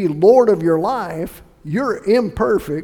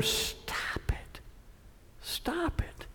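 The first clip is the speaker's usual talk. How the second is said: sharp whispered telling-off; hushed rebuke; 11 semitones higher, 15 dB quieter, much slower than usual. Real, not acted.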